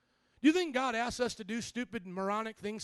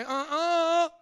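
higher, louder "Uh-uh" for the crowd; call-and-response cue; 6 semitones higher, 6 dB louder than usual. Shown as a demonstration. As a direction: lead the congregation's refusal. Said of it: "Everybody say".

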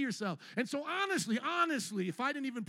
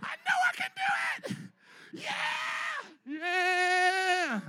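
excited crowd response; much slower than usual. Retold or imitated. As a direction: cheer it like a fired-up congregation, pitched way up. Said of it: "Y'all would be clapping".

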